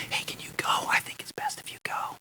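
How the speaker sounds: whispered plea to classmate. Retold or imitated. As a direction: whisper so the teacher can't hear. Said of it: "I whisper to the person".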